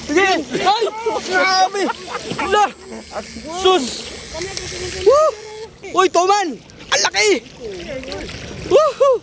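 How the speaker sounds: high-pitched whooping and exclaiming; real excited yell. Real, not acted. Angler amazed by a big fish, excited shouting. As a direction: cry out in amazement at the catch. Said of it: "Pati kami".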